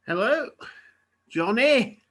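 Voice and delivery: theatrical agent's big hello; effusive phone greeting. Acted. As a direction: greet an old contact with gushing enthusiasm.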